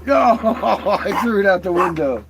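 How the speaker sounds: laughing loudly while confessing. Real, not acted. Owner laughing to the dog about the rock. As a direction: laugh through the words.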